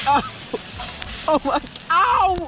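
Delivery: high, loud pained yelp. Real, not acted. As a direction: burnt fingers, yelp. Burned while flipping steak.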